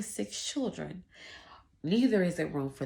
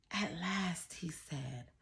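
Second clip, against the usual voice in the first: usual speaking voice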